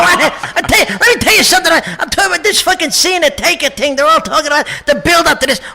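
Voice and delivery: fast, foul-mouthed wiseguy tirade; Joe Pesci impression. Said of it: "Pesci's my favorite to do".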